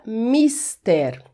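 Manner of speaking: "mister" as MIS-ter, weight on the first syllable; stress on MIS. Shown as a demonstration. Teacher showing how an accented I would sound, MIS-ter stress. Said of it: "Se ela tivesse acento na letra I".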